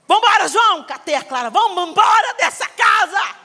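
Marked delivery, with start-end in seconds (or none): 0.1-0.8 higher, louder call to leave; angry shout. Retold or imitated. Storming out of house. 1.5-3.3 higher, louder call to leave; angry shout. Retold or imitated. Storming out of house.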